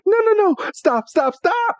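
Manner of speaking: high panicked shouting of no and stop; frantic high-pitched protest; Misty panicking. Retold or imitated. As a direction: squeal no, no, stop as you get dragged in. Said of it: "And Misty's like".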